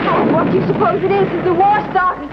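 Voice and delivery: fast, loud and high with alarm; frightened. Acted. Frightened voice asking Anne if the war started. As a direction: ask in rising panic. Frightened questions about the noise.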